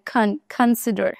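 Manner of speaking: খন, consider with an aspirated kh, khon-sider, slow; correct pronunciation. Shown as a demonstration. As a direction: stress the breathy kh in consider, like খন. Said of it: "উচ্চারণ কিন্তু কন না, খন".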